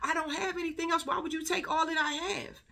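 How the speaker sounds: high-pitched retold tearful plea; imitated crying. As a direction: mimic a distraught girl pleading, tearless. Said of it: "the girl is crying".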